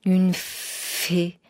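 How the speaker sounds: drawn-out amazement; astonished exclamation. A narrator's reading. Wife learns the stranger is a fairy, astonished. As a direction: marvel at it in disbelief. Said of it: "s'écria la bûcheronne".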